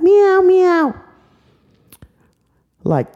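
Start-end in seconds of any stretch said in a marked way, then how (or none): 0.0-0.9 high, loud cutesy meowing; kitty meows. Acted. Little girl meowing like a kitty, high and loud. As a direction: meow like a little cat.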